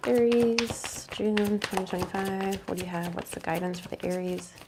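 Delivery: soft, hushed asking, nearly whispered; hushed plea to the cards. Real, not acted. Tarot reader asking the deck for Aries guidance, hushed. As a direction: ask the deck gently, like a quiet prayer. Asks the deck before drawing.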